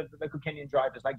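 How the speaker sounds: ordinary talk, nothing marked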